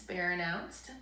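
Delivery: usual speaking voice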